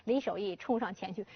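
plain reading voice

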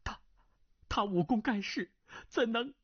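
startled. Acted. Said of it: "惊道".

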